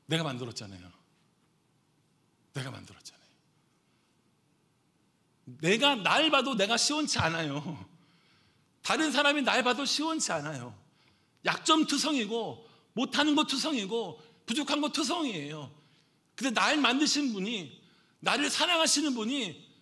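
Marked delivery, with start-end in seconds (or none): 2.5-3.2 soft, low, falling; lowered-voice emphasis; hushed repeat. Real, not acted. Repeats the answer softly for effect. 5.5-19.7 loud, high, impassioned sermon voice; raised pulpit voice, driving each phrase; forceful preaching. Real, not acted.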